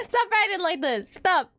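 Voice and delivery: ordinary talk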